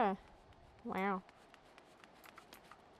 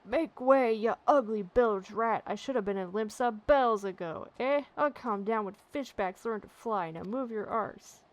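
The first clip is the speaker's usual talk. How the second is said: louder bellowing wagon driver, furious; angry coachman shout; 6 dB louder than usual. Acted. Irate driver yelling at blocking wagons, furious bellow. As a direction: roar at the crowd to clear the road.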